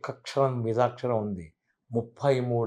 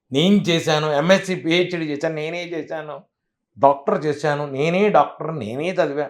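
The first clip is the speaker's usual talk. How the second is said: mock boastful pride; 5 semitones higher, 5 dB louder than usual. Mocking an arrogant, proud person.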